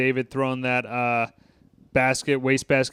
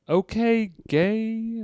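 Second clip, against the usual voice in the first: higher, put-on mocking voice; mocking tease; 8 semitones higher, much slower than usual. Friend teasing Sean, high and mocking. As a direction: tease him in a silly high voice.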